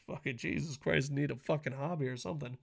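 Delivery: usual speaking voice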